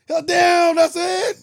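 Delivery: high, full-volume mock disbelief; hammed-up outraged shout. Retold son outraged at the quick forgiveness, high shout. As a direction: yell it as an indignant imitation. Acting outraged film character.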